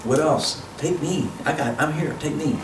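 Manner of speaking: put-on baby asks mommy to take him, soft and high; baby's voice. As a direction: speak for the baby asking to be picked up. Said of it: "turn to mommy and say".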